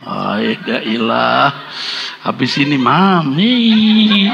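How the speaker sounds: very slow, low groaned aside; mock dismay. Husband dreading his wife after the question, slow mock dismay. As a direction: drag the words out, low.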